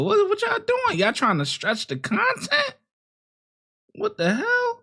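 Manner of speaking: high-pitched disbelief; what on earth are they doing; incredulous. Real, not acted. Reacting to the bodycam footage.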